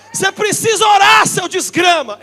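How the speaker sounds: high, loud half-joking rebuke; mock scolding yell. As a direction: yell at him to go and pray. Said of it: "Você fala".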